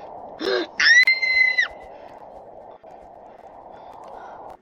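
a huge high shrieking gasp. Real, not acted. Gamer falling off a ledge, shrieking gasp. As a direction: gasp in sudden fright, way up high. Big fall in the game.

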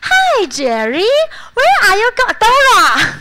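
high, loud, slow hammed-up English recording; mock an overacted listening tape; affected textbook voice. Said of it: "听音听的时候都很做作". (模仿做作的英语听力录音)

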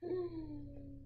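plain reading voice

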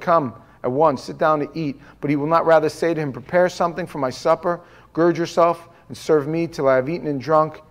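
steady, measured Bible reading; scripture reading. Acted. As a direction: read the verse plainly to the church.

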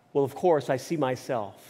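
low, deadpan story reply; mildly unamused. A narrator's reading. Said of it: "unamused, he said".

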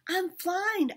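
high, loud, thrilled call; excited call. A narrator's reading. Sherman calling down to Peabody, loud and thrilled. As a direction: shout down, proud of yourself. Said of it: "I called back".

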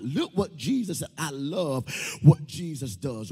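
normal talking voice

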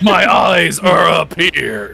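slow, loud, indignant; hammed-up prudish scolding; mock offended.

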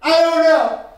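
loud, high sulky denial; mock kid denial. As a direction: play the guilty kid denying it. Kid denies bloody fight.

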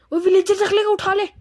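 high, loud shouted order; calling out. Real, not acted. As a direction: holler it across to a teammate. Calling out during gameplay.

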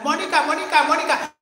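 high, loud repeated name call. Real, not acted. Calling out to Mónica. (llamando en voz alta y aguda)

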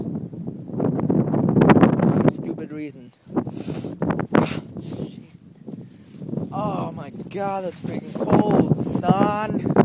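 loud, slow, shivering groan about the cold; freezing complaint. Real, not acted. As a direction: groan it out through the chill. Freezing outside in shorts.

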